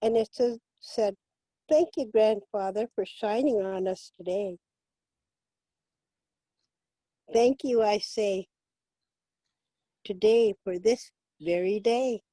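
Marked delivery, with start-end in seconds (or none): 1.7-12.2 spoken blessing. Acted. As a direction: read the prayer gently, as a gift. Reads blessing in English.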